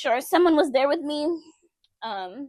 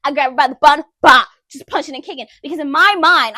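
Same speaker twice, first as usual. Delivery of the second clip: much louder, heated retelling; intense; 3 semitones higher, 10 dB louder than usual. Real, not acted. Reliving the fight in front of viewers, loud heated telling. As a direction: pour the anger out.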